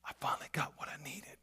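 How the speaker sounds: soft whispered Mary, overcome; Mary's whisper. Acted. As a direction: whisper it as a woman who finally found him. Mary voiced, clinging to Jesus.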